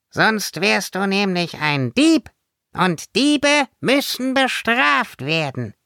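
high, menacing little fairy voice; cute fairy turning threatening; indignant fairy. A narrator's reading. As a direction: threaten punishment with prim anger. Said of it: "In den blauen Augen blitzte es erbost".

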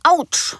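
high yelp of pain; sharp ouch. Acted. Mama burning her finger in the kitchen, high ouch. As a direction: yelp as if burned. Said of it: "Mama in der Küche rufen".

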